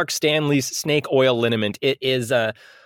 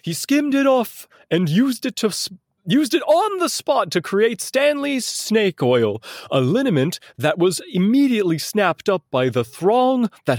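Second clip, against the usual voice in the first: resume the high, theatrical quoting voice; imagined man's voice; 6 semitones higher than usual. Acted. Resuming the quote on the snake oil spectacle, high put-on voice. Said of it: "approximate, uh, the voice of a man".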